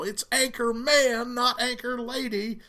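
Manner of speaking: high, loud indignant protest, drawn out; retold yelling. Newsmen yelling at the news producer about it, mock yelling. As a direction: yell it slowly as a quoted complaint. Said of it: "yelling at the news producer about it".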